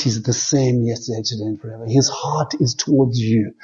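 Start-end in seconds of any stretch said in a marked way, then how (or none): none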